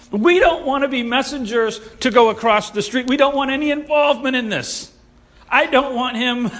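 high, emphatic protest; alarmed refusal. Acted. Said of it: "they don't want to be a part".

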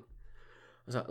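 ordinary talk

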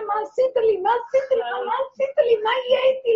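high, loud anguished wail at the grave; sobbing shouts of despair at night; crying out to God. Retold or imitated. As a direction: cry out in tears, pleading loudly. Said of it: "הייתי יוצאת כל לילה לצעוק".

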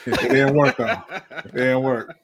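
laughing tease. Real, not acted. Co-host teasing friend about the power outage, laughing. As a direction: laugh as you rub it in.

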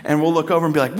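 ordinary talk, nothing marked

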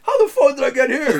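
high and loud, cracking up through the line; a goofy high bewildered voice, laughing; the friend baffled at waking up there, squeaked out through laughter; laughing Doug impression. Imitating their friend Doug lost somewhere.

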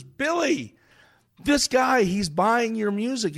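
calling out to the star. Retold or imitated. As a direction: wave the singer over. Cashier calls out to star.

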